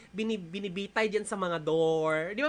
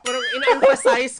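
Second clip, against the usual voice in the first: laughing, louder; 4 semitones higher, 12 dB louder than usual. Real, not acted. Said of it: "by shouting".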